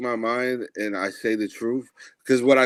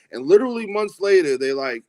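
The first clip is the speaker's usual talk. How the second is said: ordinary talk, nothing marked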